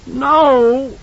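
a high, loud cry of grief; anguished cry. A narrator's reading. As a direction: scream it out in terror. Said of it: "He cried it out in a distant grief".

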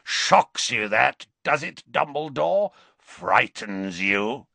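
slow teasing menace; taunting werewolf. Acted. Greyback to Dumbledore, slowly taunting. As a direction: bait him cruelly.

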